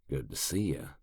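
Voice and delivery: creepy greeting after sneaking up; creepy whisper. A narrator's reading. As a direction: breathe it right behind his ear. Sneaking up behind him.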